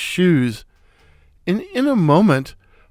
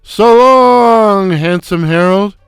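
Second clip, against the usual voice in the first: foghorn voice; 4 dB louder than usual. Acted. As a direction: bellow it from the doorway like a foghorn. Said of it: "in his foghorn voice".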